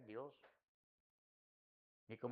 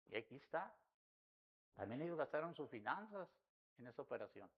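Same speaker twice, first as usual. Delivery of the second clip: ordinary delivery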